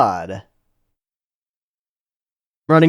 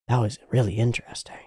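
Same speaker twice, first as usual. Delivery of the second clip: whispering; 3 semitones lower, 11 dB quieter, faster than usual. Real, not acted. Quiet reaction watching cutscene.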